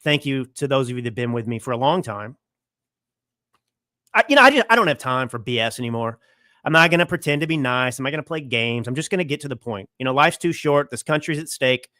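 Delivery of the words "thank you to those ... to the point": ordinary talk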